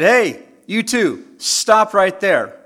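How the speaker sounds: loud, slow teacher's yell down the hall; teacher shouting at running kids; shouted command. Retold or imitated. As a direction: call out to stop them, sharp and loud. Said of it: "And I said".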